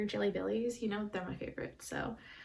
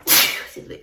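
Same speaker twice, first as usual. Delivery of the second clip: normal talking voice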